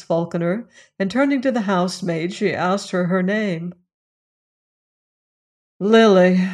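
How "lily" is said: loud, annoyed one-word reply; understated annoyance, said loudly; annoyed deep voice. A narrator's reading. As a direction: give the name with curt annoyance. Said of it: "in a deep voice of annoyance".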